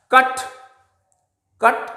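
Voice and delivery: कट with a short half a; कट said with a clipped half a sound; short a in cut. Shown as a demonstration. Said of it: "कैट नहीं बोल रहे हैं हम इसको". (कट, आधा अ, कैट नहीं)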